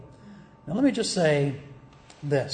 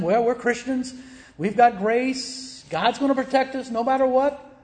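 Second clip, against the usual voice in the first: higher, mock-confident voice; smug presumptuous believer; 7 semitones higher than usual. Preacher mocks complacent believers, smug and high.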